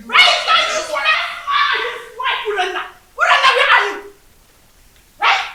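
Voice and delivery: high-pitched yell; shouting. Acted. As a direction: call out shrilly for someone in the house. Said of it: "Why are you shouting like a mad dog?".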